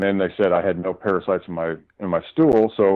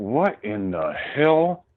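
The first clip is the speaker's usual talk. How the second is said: drawn-out disbelief; 5 semitones higher, much slower than usual. Retold or imitated. Reacting to report.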